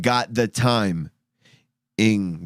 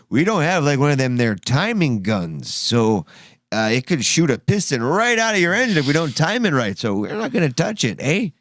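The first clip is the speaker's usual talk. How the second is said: a hammed-up small-town Pennsylvania mechanic, higher; a mock Pennsylvania mechanic refusing the car, higher; mock Pennsylvania accent; 4 semitones higher than usual. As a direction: play a Pennsylvania mechanic, loosely. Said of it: "I don't know how to do the Pennsylvanian accent".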